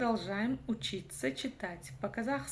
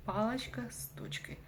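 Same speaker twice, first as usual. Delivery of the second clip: usual speaking voice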